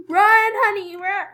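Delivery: sharp urgent call to run; urgent yell. Real, not acted. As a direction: yell at them to flee. Frantic game chase.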